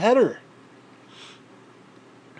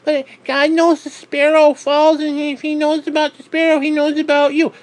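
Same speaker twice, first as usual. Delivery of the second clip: mock pious believer; 9 semitones higher, 6 dB louder than usual. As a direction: ham up a preachy consoler. Mocking religious platitudes.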